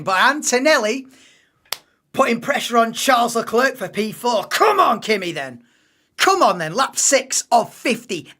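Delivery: loud, eager urging on; excited cheering. Real, not acted. Cheering driver on while watching.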